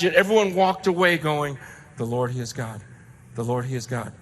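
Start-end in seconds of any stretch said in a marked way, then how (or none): 2.0-4.1 soft, low, reverent repetition; hushed awe. Retold or imitated. People leaving the mountain, softly awed. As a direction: murmur it like a stunned crowd.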